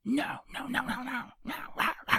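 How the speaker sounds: hushed, high imitation of yelling; mock barking yell. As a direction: bark like a dog, holding the volume back. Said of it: "yells at this kid".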